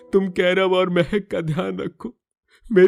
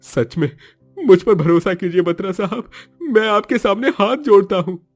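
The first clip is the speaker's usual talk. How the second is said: desperate pleading; 10 dB louder than usual. Acted. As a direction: beg for mercy, voice raised. Innocent man pleading.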